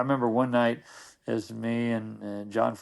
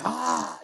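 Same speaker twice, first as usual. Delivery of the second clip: sudden high breathy "Ah!" to startle; mock scare yell; 12 semitones higher than usual. Friend jumps out of the darkness to scare John with a voiceless yell. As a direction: leap out of the dark with a far higher voiceless yell. [name] grabs John in the dark.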